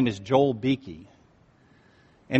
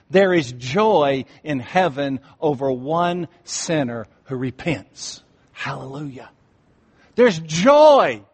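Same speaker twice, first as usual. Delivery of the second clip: louder, higher joyful proclamation; preacher lifting the congregation toward joy; rising preaching; 4 semitones higher, 4 dB louder than usual. Acted. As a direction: build to a celebrating climax.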